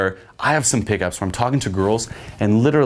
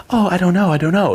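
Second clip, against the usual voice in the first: high, louder mock dithering; playful indecision; 11 semitones higher, 5 dB louder than usual. Girl judging strangers in a game, playful indecision. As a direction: act out being torn, lightly.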